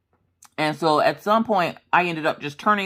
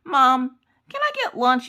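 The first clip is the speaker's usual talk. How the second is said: put-on pestering child; 8 semitones higher than usual. Daughter keeps coming down to ask mom, high pestering whine. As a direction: imitate a child calling for mom. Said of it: "she would come down, like, every ten minutes".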